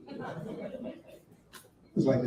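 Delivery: usual speaking voice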